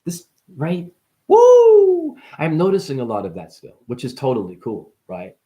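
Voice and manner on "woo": high, loud "woo"; celebratory whoop. Real, not acted. Cheering their own line after "Bars", a celebratory whoop. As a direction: let out a long high whoop.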